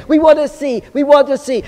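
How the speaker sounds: mock taunting. Friends badger a boy who won't show his treasure, mock taunting. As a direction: pester him with an acted, insistent demand. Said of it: "started making fun of him".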